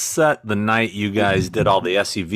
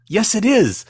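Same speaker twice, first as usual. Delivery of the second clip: mock insistence; 11 semitones higher than usual. Retold back-and-forth over a photo.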